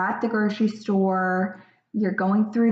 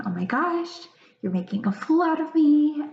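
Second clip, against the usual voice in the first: higher-pitched flustered parent's inner voice; exasperated parent; 7 semitones higher, faster than usual. Acted. Parent thinking their crying child is embarrassing them, mildly exasperated.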